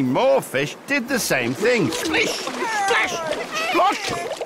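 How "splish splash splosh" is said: high, sing-out splash effects; high-pitched storybook sound words; playful splashing words. A narrator's reading. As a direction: pop each splash word up high. Imitating fish leaping into water.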